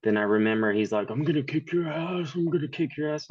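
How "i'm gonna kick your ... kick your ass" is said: higher-pitched, softly mocked tough talk; high mock threat without real force; mock offended threat. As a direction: imitate an offended guy making threats, pitched up. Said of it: "he got all offensive".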